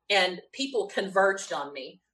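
ordinary talk, nothing marked

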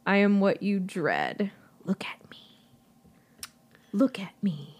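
unvoiced ancient creature whisper; eerie whisper. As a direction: whisper it like a hungry monster. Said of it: "hollow voice whispered to her".

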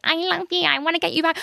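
high mock lovesick whine; mocking imitation. Mocking ex who wants her back, high imitation. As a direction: mimic his plea in a silly high voice.

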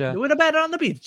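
put-on high-pitched copycat Italian accent. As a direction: echo it in a playful fake Italian accent. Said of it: "talk in an Italian accent".

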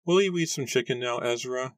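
high-pitched mother's voice; mother asking. A narrator's reading. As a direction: coax a small boy, pitched well up. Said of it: "a weariness to her voice".

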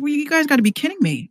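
high, incredulous retold outburst; exasperated high-pitched disbelief. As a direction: throw up your hands, disbelieving. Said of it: "I spazzed out".

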